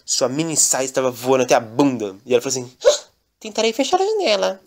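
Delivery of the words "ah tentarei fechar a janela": lightly voiced girl. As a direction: lift the voice for Fiona. Fiona and the wind.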